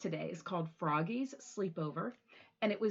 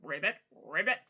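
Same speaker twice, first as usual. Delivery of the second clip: low croaky ribbit; frog croak; 5 semitones lower, faster than usual. Pretend. Teacher croaking for the kids, lower, frog-like. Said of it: "sound like a frog".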